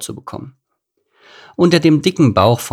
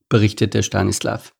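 ordinary delivery, nothing marked

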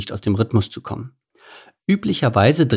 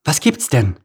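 a touch of impatience; distracted and curt; impatient; 3 semitones higher than usual. A narrator's reading. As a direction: boss barely listening. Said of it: "fragte ungeduldig".

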